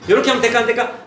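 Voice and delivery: high, projected sing-out question; teacher quizzing children. Acted. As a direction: quiz a class of small children. Acts out quizzing children in class.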